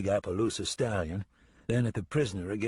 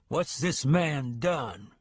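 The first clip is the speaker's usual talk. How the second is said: slow, higher, tense townsman question; tight voice; 5 semitones higher, much slower than usual. Acted. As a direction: squeeze the words out, slow and wary. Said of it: "Then he asked in a tight voice".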